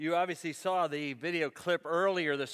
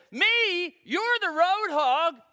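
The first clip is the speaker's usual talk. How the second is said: raised, high mock-indignant comeback; indignant retort; 9 semitones higher, 7 dB louder, much slower than usual. Harley driver answering the man who yelled, indignant retort. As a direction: throw the insult back, loud and high.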